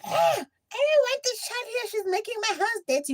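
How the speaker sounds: high, far-off mock voice of a nasty aunt; bitter aunt. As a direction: squeak it out like a snobby rich aunt.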